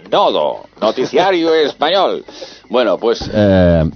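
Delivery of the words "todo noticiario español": put-on nasal old radio announcer, laughing at the end; pinched nose, old newsreel read. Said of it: "era una voz así, como nasal".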